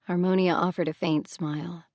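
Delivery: ordinary delivery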